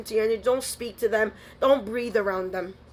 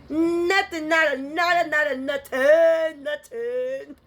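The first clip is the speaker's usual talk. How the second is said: gloating; 5 semitones higher, slower than usual. Real, not acted. Gloating over enemies' failure.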